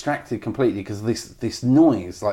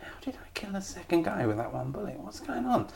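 high, puzzled whisper to himself; 11 semitones higher, 7 dB quieter than usual. Retold or imitated. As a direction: whisper it like you're baffled. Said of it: "trying to work out".

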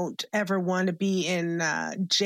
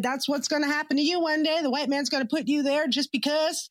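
a hint of a warning mother, slightly higher; mock ominous mother; 7 semitones higher than usual. Mother warns her child about prison, lightly voiced warning. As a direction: warn the child like a grim prophecy. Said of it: "she said".

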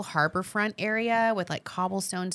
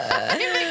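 laugh; 8 semitones higher, 4 dB louder than usual. Real, not acted. Laughing at her own joke.

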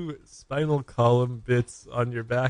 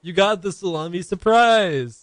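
high, loud prize reveal; excited announcement; 8 semitones higher, 6 dB louder, slower than usual. Acted. Massage finale, prize announced.

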